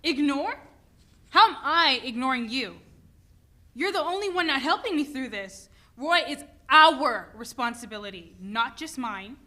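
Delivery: wife arguing with husband over their son; angry retort. Acted. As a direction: fire back at him. Wife argues back angrily.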